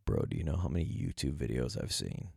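low, mumbly impression of every YouTube conspiracy guy. Imitating the typical YouTube guy for his listeners. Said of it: "I try to do the guy voice".

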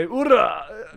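loud, high-pitched toast cry; Russian cheer. Acted. Host toasts guest with vodka, Russian cheer. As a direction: belt it out as a rousing toast. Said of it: "as the Russians say".

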